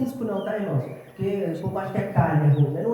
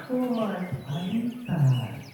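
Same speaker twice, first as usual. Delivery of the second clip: slow, softened voice of a gentle buddy; meek friend impression; 5 semitones lower, 5 dB quieter, much slower than usual. Preacher mimics his mild friend addressing him, slow and meek. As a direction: imitate someone far too mild, taking his time. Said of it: "um amigo que ele é manso demais".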